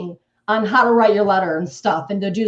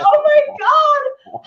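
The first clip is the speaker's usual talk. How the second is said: much higher, slow stretched "oh my God"; shocked; 3 dB louder, much slower than usual. Retold or imitated. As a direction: gasp it out in disbelief. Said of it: "I'm like".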